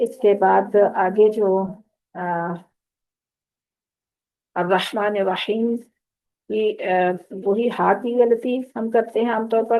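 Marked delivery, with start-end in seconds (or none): none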